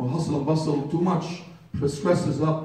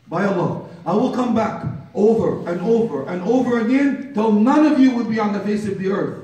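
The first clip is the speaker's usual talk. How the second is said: angel of death; 8 semitones higher, 8 dB louder than usual. Acted. Angel of death warning mourners he will return, loud proclamation. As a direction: proclaim it as an unstoppable threat.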